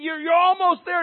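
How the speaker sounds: high, loud retold coach's shout; yelling coach. Coach urging a player who is just dying, yelling. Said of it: "The coach is there yelling at him".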